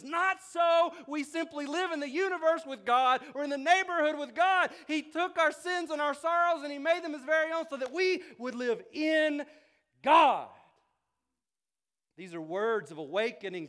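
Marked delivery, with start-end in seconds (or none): 0.0-4.7 high, loud, ringing preacher's cry; preaching at full force. Real, not acted. Preacher rousing his congregation, full-force preaching. 4.9-10.5 much higher and louder, belted out; full-force preaching repeat. Real, not acted. Preacher repeating to church, now at full force.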